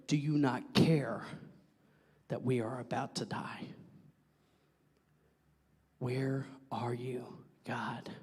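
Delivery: low hush sinking to a whisper; despairing plea. Acted. Acts out hushed despairing plea.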